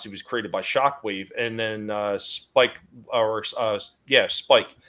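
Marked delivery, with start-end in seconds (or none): none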